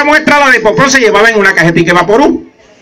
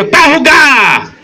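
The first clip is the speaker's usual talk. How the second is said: mock bravado; 9 semitones higher, slower than usual. Acted boast in story.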